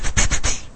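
unvoiced pst pst; quick hissed pst sounds; shushing. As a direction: hush them like an usher. Mock shushing the congregation.

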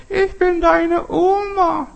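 very high, slow, sweet falsetto granny voice; the wolf fakes a tender grandmother's voice; wolf playing grandma. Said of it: "Der Teufel frisst Kreide und dann".

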